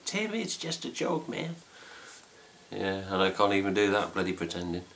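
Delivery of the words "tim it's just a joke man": lightly put-on American accent, higher; a hint of a breezy American. Mocking a friend's reply in a light American accent. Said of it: "on his American accent going".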